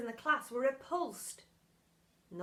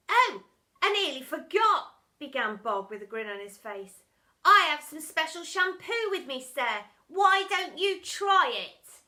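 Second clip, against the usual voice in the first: high, loud, sly schoolboy; sly boy; 4 semitones higher, 9 dB louder than usual. A narrator's reading. Boy tricking the teacher, sly. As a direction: offer it with fake innocence.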